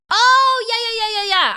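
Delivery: high, loud oh, then rattled-off yeahs; sudden realisation. Real, not acted. Streamer corrected on tortilla spelling, sudden realisation. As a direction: burst out as it clicks.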